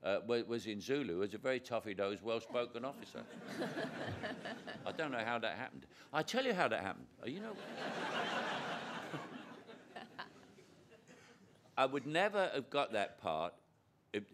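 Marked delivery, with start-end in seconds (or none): 3.3-4.8 high unvoiced burst of laughter; real amused laugh; breathy laugh. Real, not acted. Laughing at his own casting. 7.6-10.6 high unvoiced burst of laughter; real amused laugh; breathy laugh. Real, not acted. Laughing at his own casting.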